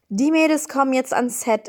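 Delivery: normal talking voice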